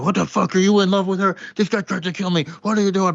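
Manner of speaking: crazy brother's outburst. Retold or imitated. Crazy brother yells at Clarence for sparing them, mock fury. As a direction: bark it at your brother like he's lost it. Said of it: "And he's like".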